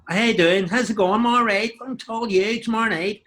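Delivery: high, loud Northern Irish lilt; Northern Irish accent. Shown as a demonstration. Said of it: "Northern Ireland, you go".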